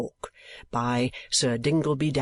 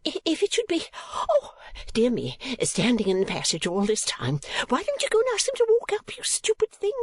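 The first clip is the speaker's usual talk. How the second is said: high-pitched fussy lady in a tizzy; stammer, then rattle out a high scolding; flustered, scolding mother; 10 semitones higher than usual. A narrator's reading. Mistress to the girl who left gentlemen waiting, flustered scolding.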